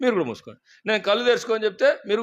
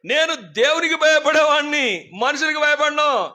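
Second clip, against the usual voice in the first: higher, slow, firm declaration; a proud fearless vow; bold Joseph; 7 semitones higher, 3 dB louder, slower than usual. Retold or imitated. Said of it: "యోసేపు ఎంత ధైర్యం చెప్తున్నంటే".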